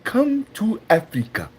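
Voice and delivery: hammed-up African accent, high and halting; mock African accent, dramatic pauses between phrases; Chaba. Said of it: "he's like".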